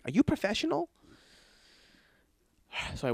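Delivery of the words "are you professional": impressed golfer. Retold or imitated. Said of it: "they're like".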